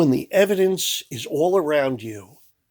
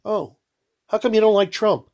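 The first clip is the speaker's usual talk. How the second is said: ordinary talk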